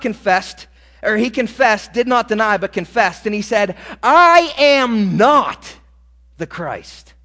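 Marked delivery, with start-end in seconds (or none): 4.0-7.1 loud, slow, biting denial; angry shout. Acted. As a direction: bellow each word back at them in anger. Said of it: "John angrily says".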